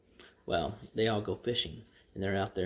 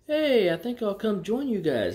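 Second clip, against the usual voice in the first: high, loud hail to friends; eager call-out; 11 semitones higher, 7 dB louder than usual. Retold or imitated. Priest in the boat hailing two pastors, eager call-out.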